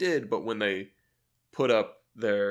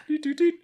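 high, louder sung beeps of a dial tone; phone tone imitation; 6 dB louder than usual. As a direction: beep it out like a phone line.

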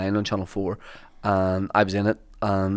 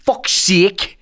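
high, loud, exasperated fuck's sake; Ian Beattie's fuck's sake; 9 semitones higher, 4 dB louder than usual. Retold or imitated. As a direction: play the exasperation big. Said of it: "the way he said".

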